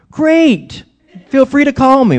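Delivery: high, loud mock cheerfulness; put-on enthusiasm. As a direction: overdo the eager welcome to hide reluctance. Retold falsely eager phone reply.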